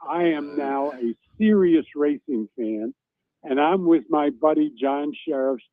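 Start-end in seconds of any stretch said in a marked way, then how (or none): none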